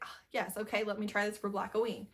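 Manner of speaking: ordinary talk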